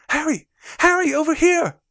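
high, loud call across a street. Acted. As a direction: shout eagerly to catch a friend's attention. Reads Hermione calling out to Harry.

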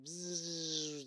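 long buzzing zap; drawn-out bzzz of shrinking magic; imitated magic buzz. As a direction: buzz like a spell striking. Magic spell hits the lion.

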